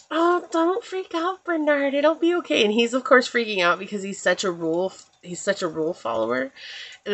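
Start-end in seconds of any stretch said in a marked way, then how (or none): 0.1-2.6 cute mouse voice. Retold or imitated. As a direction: coo it like a sweet little cartoon mouse.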